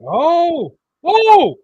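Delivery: rising high, loud shrieks of delight; shrieking jackpot winner. Real, not acted. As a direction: shriek like you just won big. Reacting to a wild sequence.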